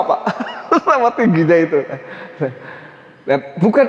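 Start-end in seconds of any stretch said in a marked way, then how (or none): 0.0-1.8 talking through laughter; amused laughing. Real, not acted. Preacher joking about his father's unchanging tone, laughing. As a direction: chuckle through the words.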